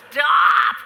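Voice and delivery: loud, high protesting yell; exasperated kids. Retold or imitated. Kids begging mother to drop the accent, loud pleading shout. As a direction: cry out like a fed-up child. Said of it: "The kids would be like".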